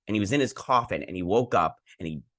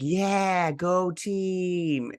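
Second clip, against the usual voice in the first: high, put-on team cheer; mock cheer; 9 semitones higher, much slower than usual. Retelling Artemis passing the team, in a high mock cheer.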